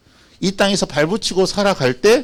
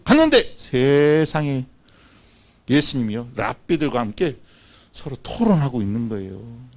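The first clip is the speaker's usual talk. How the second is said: usual speaking voice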